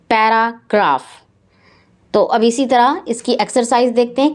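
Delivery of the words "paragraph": "paragraph" broken as para, graph; split into syllables. Shown as a demonstration. Teacher showing syllables to pupils, "paragraph" broken in parts. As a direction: say "paragraph" in two separated chunks. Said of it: "Write multisyllabic words".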